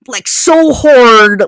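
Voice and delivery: "so hard" high, loud and very slow; emphatic SO HARD. Real, not acted. Storyteller relishing the kiss, "so hard" loudly drawn out. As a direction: belt out and stretch "so hard".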